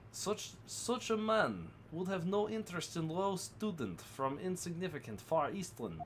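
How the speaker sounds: lightly put-on Russian accent, broken English; a hint of a gruff Russian sailor guarding the cabins. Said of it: "same Russian voice".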